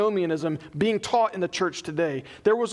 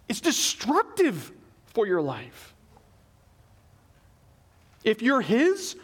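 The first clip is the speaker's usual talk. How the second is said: ordinary talk, nothing marked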